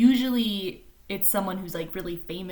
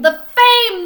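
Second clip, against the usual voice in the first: rising thrill; 17 dB louder than usual. A narrator's reading. Repeated for rising effect.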